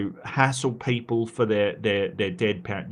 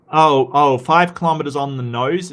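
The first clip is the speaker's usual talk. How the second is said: much louder, delighted; excited exclamation; 2 semitones higher, 7 dB louder than usual. Real, not acted. Streamer cheering Ember's five-kilometer walk, loudly excited. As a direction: cheer a surprising number. Reacting to donation of walking.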